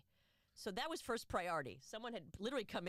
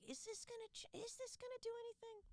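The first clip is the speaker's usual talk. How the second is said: pitched way up, doubting; high worried inner voice; 7 dB quieter than usual. Retold or imitated. Protester doubting the cause, in a high unsure voice. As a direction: ask it high and unsure, half to yourself. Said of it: "but I'm like".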